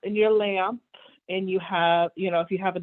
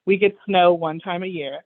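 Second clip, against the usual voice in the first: normal talking voice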